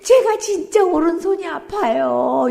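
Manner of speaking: slow, tearful, high; crying like a baby. Retold or imitated. Deaconess confessing her hurt hand, crying. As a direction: sob out the pain. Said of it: "우는 거예요, 애기처럼".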